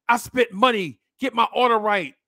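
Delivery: mock angry demand at the counter; irate customer. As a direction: imitate a customer storming back to complain. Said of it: "storm back up to the place".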